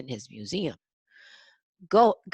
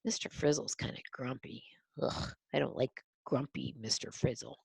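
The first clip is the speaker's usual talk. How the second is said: whisper; 4 semitones lower, 9 dB quieter than usual. Real, not acted. Whispered aside.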